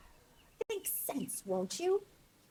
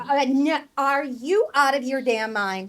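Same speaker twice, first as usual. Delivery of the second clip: loud, incredulous outburst; raised-voice disbelief; appalled objection; 5 semitones higher, 10 dB louder than usual. Acted. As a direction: object as if she has lost her senses. Friend appalled at helping a snake.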